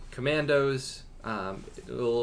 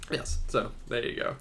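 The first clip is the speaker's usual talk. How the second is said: ordinary talk, nothing marked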